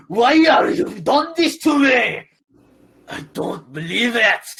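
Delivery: slow, high, loud Irish wail; Irish accent demo. Shown as a demonstration. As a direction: show the Irish accent line slowly. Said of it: "say what you wanna say, and I'll do it for you".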